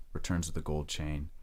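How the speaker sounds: ordinary talk, nothing marked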